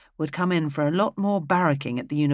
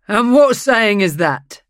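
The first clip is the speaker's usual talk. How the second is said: jolly question; 6 semitones higher, 10 dB louder than usual. A narrator's reading.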